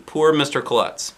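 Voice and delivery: usual speaking voice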